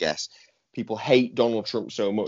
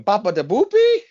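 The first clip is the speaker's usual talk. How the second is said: much higher mock Italian babble, laughing through it; hammed-up fake Italian nonsense; fake Italian gibberish; 12 semitones higher, 7 dB louder than usual. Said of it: "he's going".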